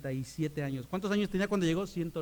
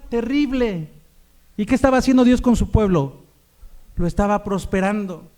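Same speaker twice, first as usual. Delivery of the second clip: loud, high preaching climax; full-force preaching; 3 semitones higher, 8 dB louder than usual. Acted. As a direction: shout the point to the church.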